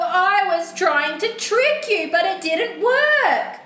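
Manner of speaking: loud, high "no", then urgent explaining; desperate book character. Acted. Book character warning the reader of a witch's curse, desperate.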